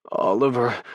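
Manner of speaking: name torn out as an ache; aching. A narrator's reading. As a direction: moan the name with aching need. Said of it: "My name is an ache".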